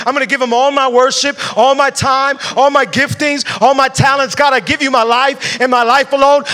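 rising into a loud, passionate declaration; building fervent vow. Acted. Pastor voicing a believer's vow to God, building fervent preaching.

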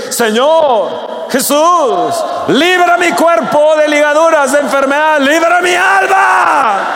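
crying out to God. Shown as a demonstration. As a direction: model the cry for the congregation to repeat. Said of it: "decir ese grito". (grito de súplica, muy agudo y fuerte)

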